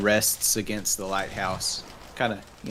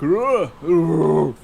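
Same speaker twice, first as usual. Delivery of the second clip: loud, high growling grunts; owlbear voice; 5 semitones higher, 9 dB louder than usual. Acted. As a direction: grunt like a big beast. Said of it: "owlbear noises".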